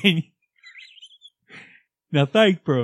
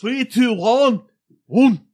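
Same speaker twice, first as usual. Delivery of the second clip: louder, higher Scottish countdown; Brett voice countdown; 7 semitones higher, 7 dB louder, slower than usual. Pretend. Host counting down the show intro, loud Scottish countdown. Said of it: "in your Brett voice".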